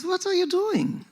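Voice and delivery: put-on high, slow German-accented scolding; German manager imitation, pitched high. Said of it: "I wanna hear your German accent".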